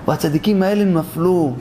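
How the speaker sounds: ordinary talk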